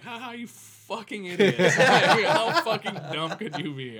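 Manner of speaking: high, loud cackling gloat at the thieves; laughing taunt. Retold or imitated. Gloating at the busted thieves, loud laughing taunt. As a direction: laugh right in their faces, loud and high.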